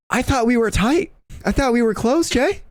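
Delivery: high whiny mock heartbreak; mock hurt. Pleading with his teammate Jay that they were close, high mock hurt whine.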